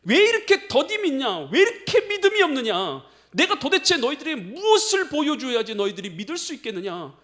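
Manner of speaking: loud, high preacher's reproach; rebuking. Acted. Jesus confronting today's unbelievers, loud rebuke. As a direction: cry out the challenge, high and forceful. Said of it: "똑같이 말씀하실 것 같아요".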